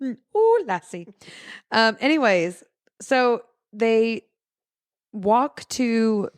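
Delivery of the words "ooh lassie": cooing put-on Irish; mock Irish accent. Playing along with the Irish bit, cooing mock accent. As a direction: swoop up on "Ooh" in silly Irish. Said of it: "with an Irish accent".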